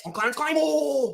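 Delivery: high, loud drawn-out battle cry; from the chest. Acted. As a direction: bellow your name slowly with your whole chest. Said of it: "Say it with your fucking chest".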